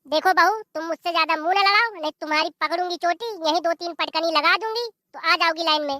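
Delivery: ordinary delivery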